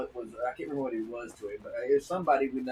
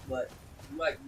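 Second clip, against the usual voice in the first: ordinary talk, nothing marked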